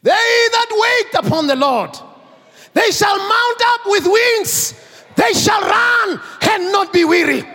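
high, loud preacher's proclamation; preaching at full force. Acted. The preacher to the congregation, proclaiming in a high, loud voice.

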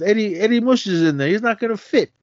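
high, incredulous gangster objection; mock protest. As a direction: object like it's obvious. Gangster protesting in retold scene.

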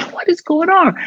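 high-pitched, retold bewilderment; baffled. Actor baffled by Raven's odd phone call, bewildered. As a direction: ask it in mock disbelief.

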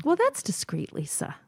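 high sarcastic lilt on that's; playfully mock her idea of discreet; teasing irony. Real, not acted. Teasing Lisa about pulling tags off strangers, sarcastic lilt.

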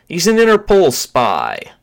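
loud, high counter-accusation; heated accusation. Acted. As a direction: denounce the spy forcefully. Bearded man counters the accusation.

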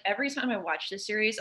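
ordinary delivery

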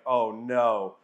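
low, dreading groan of oh no; mock dread. Caught-out disciple facing Jesus, low mock dread. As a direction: sink into mock dread as he calls you over. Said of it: "You're like".

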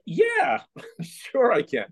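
high-pitched yeah, then drawn-out sure; overeager bluff. Retold or imitated. Unmechanical page bluffing to rock star, overeager high agreement. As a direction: agree far too readily, laughing at yourself.

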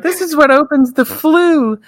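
high, sing-song aunt voice. Retold or imitated. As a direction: chirp it like a sweet old aunt. Voicing the aunts.

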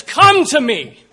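slow, loud preacher's cry; booming call. Acted. Preacher urging the congregation to come, booming slowly. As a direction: call it out slowly at full force.

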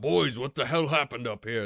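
a leader's angry shout up the stairs. Acted. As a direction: bark it at the boys as you burst in. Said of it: "he just shouts out".

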